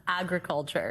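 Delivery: put-on Minnesota accent. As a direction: say agriculture like a Minnesotan. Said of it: "Oh, I know how you say this".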